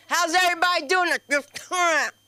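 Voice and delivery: high, loud sing-song cover-up; fake-cheery host. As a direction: switch to a bright host greeting. Covering faces made at husband.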